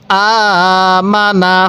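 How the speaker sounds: slow "আমানা" with a long first "আ"; stretched opening vowel. Shown as a demonstration. Teacher leading read-along, "আমানা" with long opening vowel. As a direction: hold "আ" steady, then say "মানা". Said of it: "এরকমভাবে পড়বেন".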